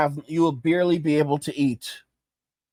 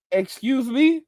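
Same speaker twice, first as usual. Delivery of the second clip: a much higher, startled 'Excuse me?'; mock offended; 8 semitones higher, slower than usual. Boomer taken aback by the accusation, mock offended squeak. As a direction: act affronted at the question.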